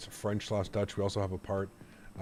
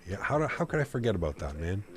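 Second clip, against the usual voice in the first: usual speaking voice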